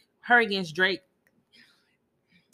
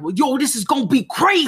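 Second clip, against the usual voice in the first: loud, higher hype rapper voice; [name] impression; 7 semitones higher, 8 dB louder than usual. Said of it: "doing that [name]".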